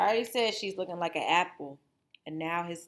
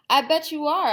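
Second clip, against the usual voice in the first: louder, knowing wolfish tone; sly agreement; 8 semitones higher, 8 dB louder than usual. Acted. Wolf humouring boastful Little Red, sly and loud. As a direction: humour her with a wink.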